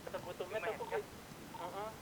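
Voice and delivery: ordinary delivery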